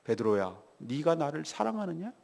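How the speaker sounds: low, soft and tender asking; gentle, unreproaching question. Retold or imitated. Jesus asking Peter if he loves him, low and gentle. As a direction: voice a kindly master softly, lifting at the end. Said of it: "따뜻하게 먹이시고 책망하지 아니하시고".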